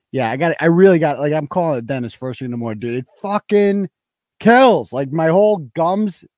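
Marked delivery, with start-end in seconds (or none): 3.0-6.2 loud, high pained yell; pained outburst. Real, not acted. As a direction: let the pain burst out. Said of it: "It really hurts".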